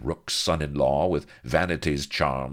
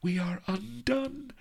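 gasped. A narrator's reading. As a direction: gasp it out in alarm. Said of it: "gasped Vanity".

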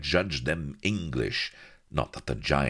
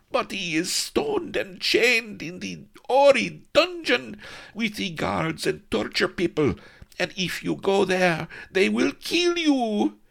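high, loud, slow panicked protest; frightened pleading; 6 dB louder, slower than usual. Acted. Melliflua begging Ben not to go to the dungeon. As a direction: warn him in rising terror. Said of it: "Melliflua was horrified".

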